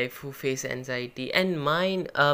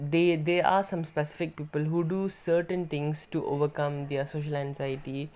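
ordinary delivery

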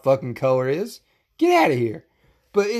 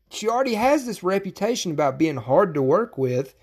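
ordinary talk

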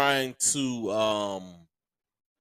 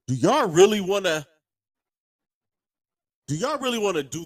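higher, exasperated rant at the fans; angry rant; 6 semitones higher, 4 dB louder than usual. Real, not acted. Podcaster ranting at Buckeye Nation, exasperated. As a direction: call out the fan base, fed up.

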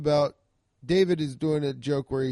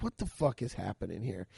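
hushed disbelief; 6 semitones lower, 9 dB quieter than usual. Retold or imitated. Comic's inner reaction to commotion.

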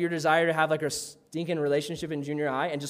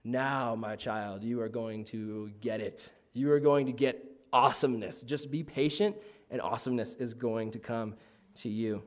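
God's voice; 7 semitones lower, 3 dB quieter than usual. Retold or imitated. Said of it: "God's stoked 'cause he's just like".

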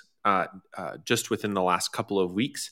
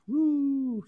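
a half-hearted little whoop, pitched way up; mock cheer. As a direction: token cheer for something underwhelming. Mock cheer at an old post.

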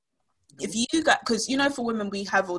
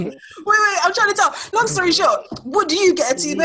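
laughing through the question; giggling loudly while asking; 9 semitones higher, 7 dB louder than usual. Real, not acted.

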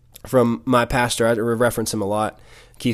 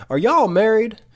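put-on high Mississippi-accented question; Keith Moore impression; 10 semitones higher, 4 dB louder than usual. Pastor questioning the affectionate couple, in a Mississippi accent. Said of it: "I'm gonna try to talk like him".

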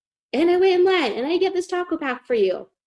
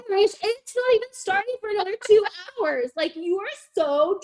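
exasperated; 9 semitones higher than usual. Retold or imitated. Exasperated at drunk boyfriend.

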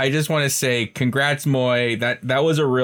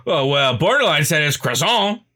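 mock know-it-all voice; 7 semitones higher than usual. Teasing a friend, mocking quoter in a raised voice. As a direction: mimic someone smugly repeating a pronunciation. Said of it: "You start saying that".

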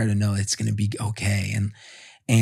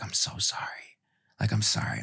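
breathless whisper, softer; whispered apology; 8 dB quieter than usual. Retold or imitated. Wife in labour apologising to husband, whispered. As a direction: whisper it as if barely able to speak. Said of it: "she was like".

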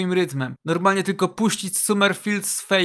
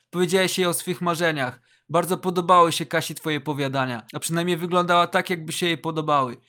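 ordinary delivery, nothing marked

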